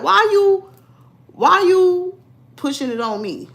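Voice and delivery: high, exasperated acted protest; mock protest. Acted protest at pushy matchmaker.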